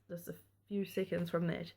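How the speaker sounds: usual speaking voice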